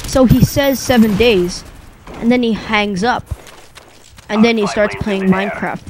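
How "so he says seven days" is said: ordinary delivery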